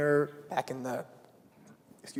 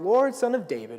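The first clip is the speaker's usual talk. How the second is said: pleading call, louder and higher; crying out; 6 semitones higher, 6 dB louder than usual. Retold or imitated. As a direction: call out to the Lord in desperation. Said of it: "she starts crying out".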